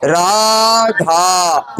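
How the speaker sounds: Radha with each आ held very long; long, sustained आ sounds in Radha; drawn-out vowel. Shown as a demonstration. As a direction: stretch Radha out slowly, vowel by vowel. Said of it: "लंबा खींचना".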